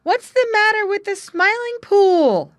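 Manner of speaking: high, loud breathless call; two young animals calling to the old frog; breathless shout. Acted. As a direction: shout it as you arrive panting. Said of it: "they shouted as they came up quite out of breath".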